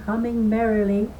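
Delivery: ordinary delivery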